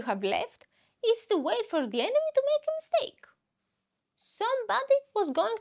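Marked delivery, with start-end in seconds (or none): 2.3-3.3 dramatic high. Real, not acted. Enemy making a mistake, pitch jump.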